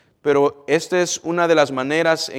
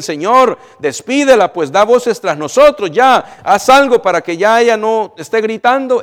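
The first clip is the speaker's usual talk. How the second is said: loud, higher, fed-up urging; exasperated plea; 6 semitones higher, 8 dB louder than usual. Acted. Apostles urge Jesus to send the shouting woman away, loud and exasperated. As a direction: press him hard to send her away. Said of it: "los apóstoles vienen y le dicen".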